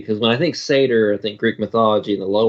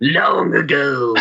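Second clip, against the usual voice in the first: slow, higher, drawn-out mock-eerie opening; spooky storyteller voice; 5 semitones higher, slower than usual. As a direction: stretch it out like a hammed-up ghost story. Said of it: "talk that way to tell the story".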